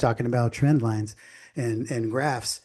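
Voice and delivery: usual speaking voice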